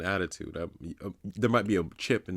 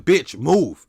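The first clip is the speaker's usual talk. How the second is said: louder and higher, punchy two-word shout; mock yell; 7 semitones higher, 11 dB louder than usual. To the confused man in the aisle, a loud mock shout. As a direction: bark it at him to get out of the way. Imagined repeat rude demand.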